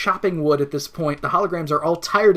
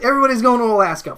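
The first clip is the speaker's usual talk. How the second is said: higher and louder, a quick chipper call; mock excited announcement; 6 semitones higher, 6 dB louder, faster than usual. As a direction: rattle it off like a cheery plan. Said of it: "where it's just like".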